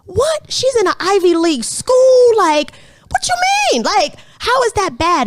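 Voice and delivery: high, loud, incredulous squeal; incredulous disbelief. Retold or imitated. To her friend about the Ivy League daughter, a high, loud, stunned squeal. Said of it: "I'm like".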